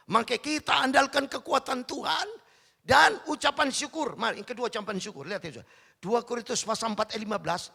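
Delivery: loud, high-pitched sermon proclamation; forceful preaching. Real, not acted. Preacher urging thanksgiving from scripture, forceful. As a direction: declaim it to the congregation with full force.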